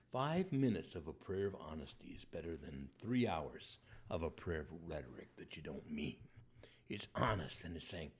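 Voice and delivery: ordinary talk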